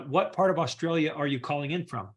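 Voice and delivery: usual speaking voice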